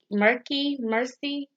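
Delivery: usual speaking voice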